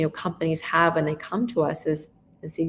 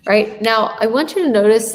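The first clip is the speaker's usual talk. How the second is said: louder; 3 semitones higher, 7 dB louder than usual. Shown as a demonstration. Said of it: "about my tone".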